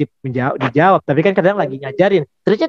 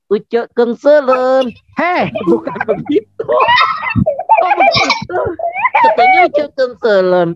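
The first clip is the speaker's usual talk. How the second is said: puppet voice; 9 semitones higher than usual. Acted. Puppet Odi arguing with host.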